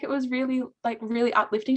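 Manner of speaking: ordinary delivery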